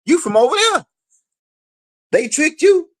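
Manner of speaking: high, mocking voice scoffing at him; high scornful put-down; mocking Africans. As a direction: pitch it way up like a scornful elder dismissing him. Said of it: "You know what they told him?".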